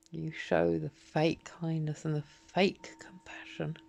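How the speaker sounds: a real, low, scornful whisper; bitter whisper. Real, not acted. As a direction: hiss the accusation under the breath. Bitter accusation dropping to whisper.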